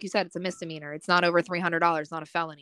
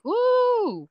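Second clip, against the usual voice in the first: mock woo; 6 dB louder than usual. Acting out hiding weed.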